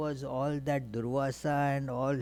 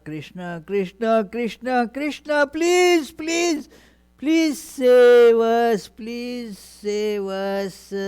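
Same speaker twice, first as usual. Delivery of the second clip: high, loud pleading, drawn out at the end; desperate prayer; 9 semitones higher, 11 dB louder, slower than usual. Acted. Draupadi calls on Krishna to save them, desperate pleading. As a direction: cry out urgently, climbing in pitch, then stretching the plea. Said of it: "while she started remembering Krishna".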